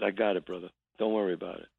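put-on low, soft reassurance to his old friend; reassuring. Telling his newly made friend he understands, low and soft. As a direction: settle it gently, brother to brother.

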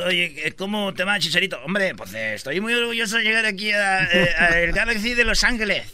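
Spain Spanish lisp and lilt; Spanish accent. Retold or imitated. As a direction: talk like a Spaniard. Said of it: "ya hablan como español".

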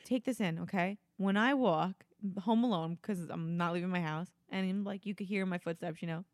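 hushed and low; dramatic low confession; quiet. Real, not acted.

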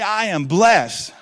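loud, high, drawn-out declaration; top of lungs. Shown as a demonstration. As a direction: shout it slowly for the congregation to repeat. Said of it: "at the top of your lungs".

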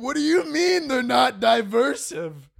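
very high, drawn-out outraged question; mock disbelief. Public questioning the royal family, high mock disbelief. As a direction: squeal it slowly in feigned shock. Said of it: "They're like".

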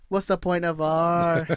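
slow, stretched-out delivery ending on a long VAR; drawn-out catchphrase. Shown as a demonstration. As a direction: drag out VAR like a slogan. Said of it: "like, a voice message saying".

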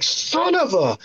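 high, exasperated half curse; cut-off curse. Retold or imitated. Watching his tight end fumble, high frustrated half curse. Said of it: "I'm like".

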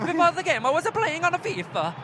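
high-pitched mock Italian; lilting comic Italian accent. Podcaster quotes Pirlo, Italian accent. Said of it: "That's what he said".

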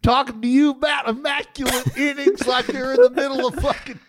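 high, hammed-up cattle-ranch twang accent. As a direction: tease with a high square-dance accent. Co-host copies the mocked hick accent.

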